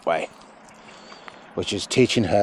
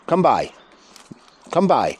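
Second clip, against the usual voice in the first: herding command; 6 semitones higher than usual. Real, not acted. Trainer commanding the sheepdog to circle. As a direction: send the dog round with a raised call.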